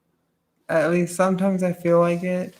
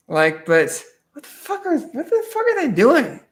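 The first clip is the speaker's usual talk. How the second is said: ordinary delivery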